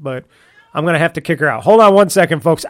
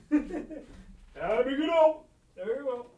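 slow, higher, soft laugh through the words; shooing the cat out through laughter; 8 semitones higher, 13 dB quieter, slower than usual. Real, not acted. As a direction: giggle while shooing her out.

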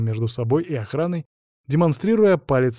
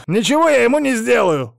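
loud, high-pitched outraged bellow; roar; 11 semitones higher, 7 dB louder than usual. A narrator's reading. Hostage protesting to the boss on the phone, roaring. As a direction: roar the denial. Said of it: "взревел Айзатов".

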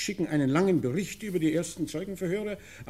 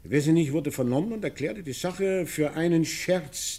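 ordinary talk, nothing marked

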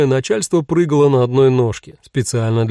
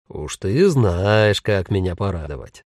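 slow, low, pleased male voice; approving ghost husband; 5 semitones lower, slower than usual. Acted. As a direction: savour it approvingly. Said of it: "одобрительно сказал Пауль".

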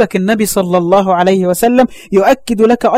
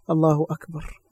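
choked up; 4 semitones lower, 10 dB quieter than usual. Real, not acted. Preacher choked exclamation.

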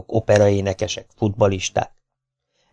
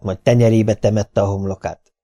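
ordinary delivery, nothing marked

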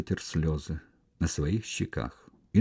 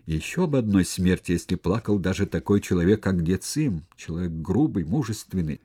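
plain reading voice

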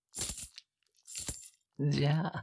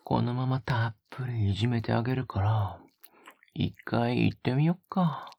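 breathy close-mic whispers with a teasing edge; a lover's hushed, teasing murmur; intimate ASMR whisper; 3 semitones lower than usual. Acted. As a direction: whisper right into the listener's ear, coaxing. Intimate whispered roleplay throughout.

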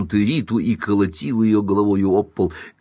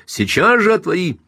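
raised, imperious order; commanding shout; 10 semitones higher, 4 dB louder than usual. A narrator's reading. Husband orders wife to open up, commanding shout. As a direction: order the door opened at once. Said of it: "властно закричал он".

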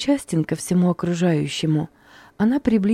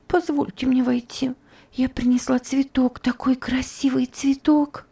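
put-on higher, begging child's voice, fading to a whisper; Topsy begs Rosa to let her in, pleading; pleading girl; 6 semitones higher than usual. A narrator's reading. Pleading girl's voice. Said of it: "сказала умоляющим голосом Топси".

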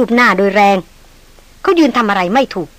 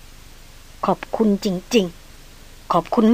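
very slow, soft, deadpan sarcasm; flat dry thanks; 2 semitones lower, 10 dB quieter, much slower than usual. Acted. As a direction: drawl the thanks out dryly. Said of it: "พร้อมกับเสียงเรียบๆว่า".

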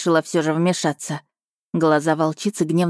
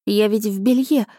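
higher, apologetic stammering excuse; flustered babble; 4 semitones higher than usual. A narrator's reading. Heroine caught in underwear excuses herself, flustered. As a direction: plead embarrassment in a higher voice. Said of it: "пролепетала, оправдываясь".

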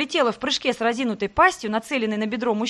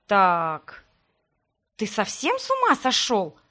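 hissed; slower than usual. Acted. Heroine hisses at the necromancer for spying. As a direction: hiss it at him in disbelief. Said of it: "прошипев".

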